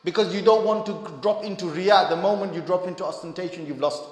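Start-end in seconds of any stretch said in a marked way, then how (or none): none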